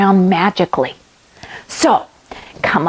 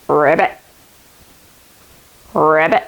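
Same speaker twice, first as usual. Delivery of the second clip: low ribbit; frog croak; 7 semitones lower than usual. Pretend. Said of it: "let's become a frog".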